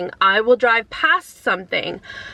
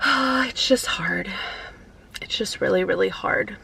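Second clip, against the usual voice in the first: quiet grief; 5 dB quieter than usual. Real, not acted. Daughter speaking of her late dad, softly tearful. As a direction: let the words sink, barely holding together. Grieving daughter after a sigh.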